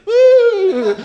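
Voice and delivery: loud, very high long "woo"; imitated high-pitched drunk holler; drunken whoop. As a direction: let out a piercing, drawn-out whoop. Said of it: "like that".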